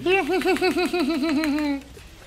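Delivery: high, loud burst of laughter; high-pitched gleeful laugh. Real, not acted. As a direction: crack up at your own play. Laughing at own play.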